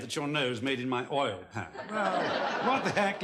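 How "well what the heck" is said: usual speaking voice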